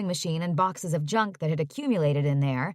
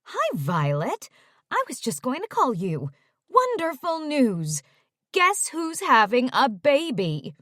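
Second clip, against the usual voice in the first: happy; 6 semitones higher than usual. Acted. Mom announcing a baby to her daughter, happily. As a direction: spill the happy news. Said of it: "Mom said happily".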